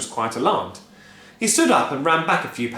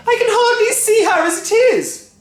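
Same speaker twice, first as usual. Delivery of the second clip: high stammering wail; 11 dB louder than usual. A narrator's reading. Wife wailing as her mother vanishes. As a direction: wail helplessly.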